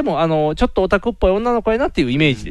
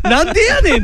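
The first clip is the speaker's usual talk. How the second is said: a high, laughing comeback that cracks up; laughing "what the heck" retort; 11 semitones higher, 3 dB louder than usual. Retold or imitated. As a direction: toss it off while laughing. Laughing retort at crying brother.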